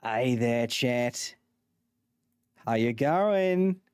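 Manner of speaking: put-on Aussie accent; broad practice Australian twang. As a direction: play an Australian streamer chatting to viewers. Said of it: "practice my Australian accent".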